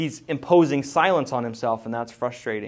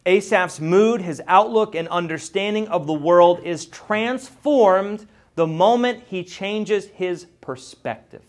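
loud, slower, emphatic sermon delivery; building preaching; 6 semitones higher, 7 dB louder, slower than usual. Acted. Preacher driving home Asaph's transformation to the congregation, loud and slow. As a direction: drive the point home slowly.

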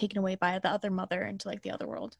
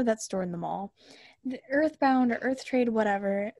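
ordinary delivery

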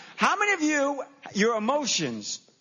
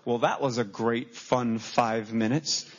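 lower, slow deadpan sarcasm; sarcastic teen; 9 semitones lower, slower than usual. Retold or imitated. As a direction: drip sarcasm as you walk out. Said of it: "stands up and go".

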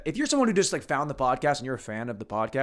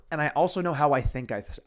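ordinary talk, nothing marked

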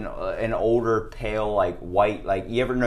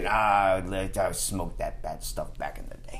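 gruff New Yorker; 4 semitones lower, 7 dB quieter than usual. Retold or imitated. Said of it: "New York attitude".

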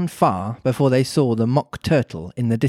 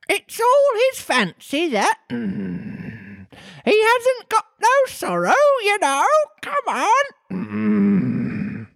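very high squawking voice; Griffin voice; slower than usual. Acted. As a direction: squawk it like a parrot. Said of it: "the Griffin answered".

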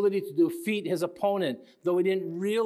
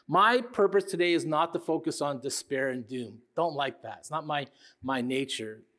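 usual speaking voice

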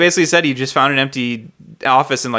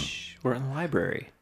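hushed shush, almost a whisper; librarian shushing whisper; 3 semitones lower, 16 dB quieter than usual. Pretend. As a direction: keep it library quiet. Shushing the others, library joke.

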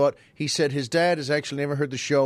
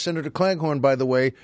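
ordinary talk

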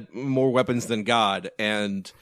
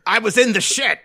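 higher-pitched imitation of a defiant cop yelling; mock yelling; 5 semitones higher, 3 dB louder than usual. Podcaster acts out cop defying his pursuers, mock yelling. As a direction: shout it like a blustering movie cop, hammed-up. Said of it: "and he's yelling".

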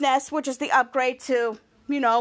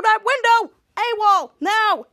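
raised, higher-pitched order; urgent command; 7 semitones higher, 5 dB louder, slower than usual. Acted. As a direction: call in the secret weapon urgently. Captain calls in secret weapon.

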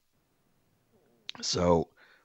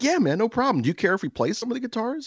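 high, quick imitation of an agreeable buddy; eager, friendly. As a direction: act out an easygoing friend, pitched up. Buddy retold as trusting, easygoing.

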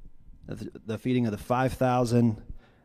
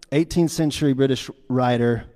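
ordinary talk

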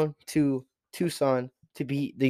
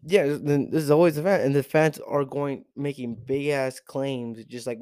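usual speaking voice

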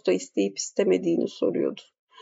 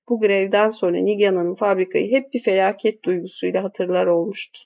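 ordinary delivery

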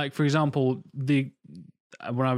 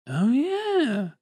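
high, drawn-out "oh yeah" of discovery; dawning realisation; 11 semitones higher, slower than usual. Acted. Actor finding the accent works, delighted realisation. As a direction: let the penny drop, pitched high. Voicing the moment it clicks.

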